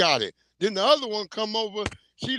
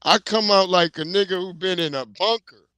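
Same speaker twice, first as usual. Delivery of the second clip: usual speaking voice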